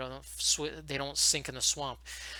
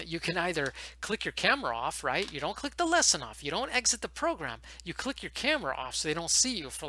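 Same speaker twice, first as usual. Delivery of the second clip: ordinary talk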